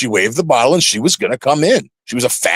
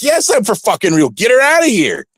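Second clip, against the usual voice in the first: retold exasperated yell; 9 semitones higher than usual. Yelling at the security guard to remove the drunk fan. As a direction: pitch it up higher and higher, mock yelling at the guard. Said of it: "And I'm like".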